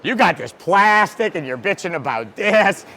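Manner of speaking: telling off whiners as a retold rant; mock rant. To people moaning about plastic racks, a building rant. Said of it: "I'm like".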